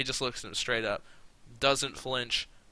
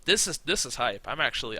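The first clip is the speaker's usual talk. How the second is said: usual speaking voice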